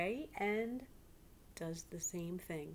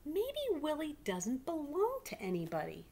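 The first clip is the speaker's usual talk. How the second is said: higher, wistful storytelling; hopeful read-aloud; 8 semitones higher, 3 dB louder than usual. A narrator's reading. Girl hoping Willie belongs to no one, wistful. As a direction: let the hope lift the line. Hopeful narration and girl's line.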